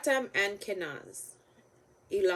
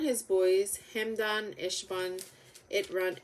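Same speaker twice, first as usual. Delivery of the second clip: plain reading voice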